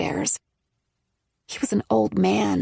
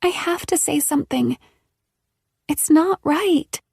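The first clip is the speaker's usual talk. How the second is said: sorrowful; 8 semitones higher than usual. A narrator's reading. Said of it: "her voice filled with sorrow".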